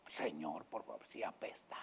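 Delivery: low, whispered objection; sister's plea. Pretend. Lazarus's sister warns Jesus of the smell, whispering. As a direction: murmur it pleading. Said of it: "viene la hermana".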